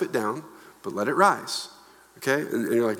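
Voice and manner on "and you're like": ordinary talk, nothing marked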